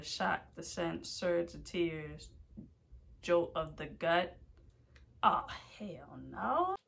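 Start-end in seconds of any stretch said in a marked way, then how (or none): 5.2-6.8 whispered dread. Real, not acted. Vlogger reading the scary warning, whispered dread. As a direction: breathe it out in disbelief.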